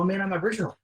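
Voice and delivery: put-on a kid proudly stating who they are; proud declaration. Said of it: "So really proudly".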